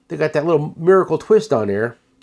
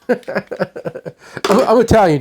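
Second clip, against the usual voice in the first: laughing through the words, higher; amused, louder as the laugh breaks; 7 semitones higher, 4 dB louder, much slower than usual. Real, not acted. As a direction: crack up at your own joke while talking. Laughing at his own accent joke.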